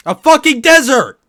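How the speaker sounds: shrill comic outburst; yelling. Real, not acted. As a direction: belt out the punchline. Punchline yelled after setup.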